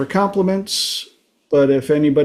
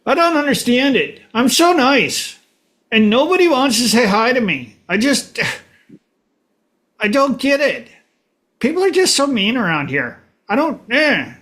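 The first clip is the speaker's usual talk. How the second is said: whiny complainer; 8 semitones higher than usual. Retold or imitated. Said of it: "you're just like".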